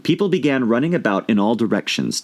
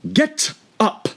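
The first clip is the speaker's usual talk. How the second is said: high, piercing queen's order; shrill loud queen. A narrator's reading. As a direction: snap them to their feet. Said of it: "in a shrill, loud voice".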